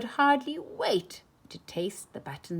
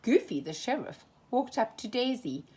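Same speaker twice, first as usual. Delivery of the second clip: usual speaking voice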